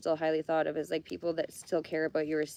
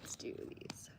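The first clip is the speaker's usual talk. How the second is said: whispering; 16 dB quieter than usual. Real, not acted. Murmuring to self, arranging cards.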